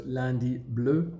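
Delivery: Lundi Bleu drawn out slowly in French; slow French pronunciation. Shown as a demonstration. Introducing a small brand to viewers. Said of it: "two words".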